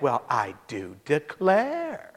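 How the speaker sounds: low, slow, soft start swooping up at the end; affronted Alabama lady. Retold or imitated. Lady asked if she is a sinner, mock affronted. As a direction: imitate a Southern lady, stretched out.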